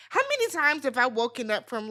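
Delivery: normal talking voice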